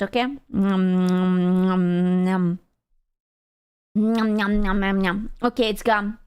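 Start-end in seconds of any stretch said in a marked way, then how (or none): 0.2-5.3 low nom nom nom while crunching candy; mock chewing noises. Shown as a demonstration. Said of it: "I'm gonna crunch it".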